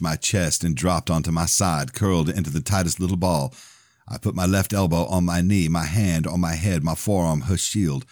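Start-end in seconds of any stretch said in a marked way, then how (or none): none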